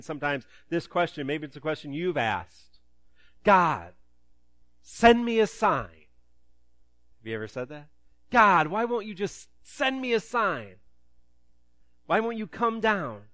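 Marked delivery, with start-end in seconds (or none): 3.4-6.1 higher, louder, slow plea of "send me a sign"; mock pleading prayer. A believer calling up to God, half-mocked pleading. 8.3-13.3 mock frustrated plea. A frustrated believer demanding a sign from God, mock exasperated.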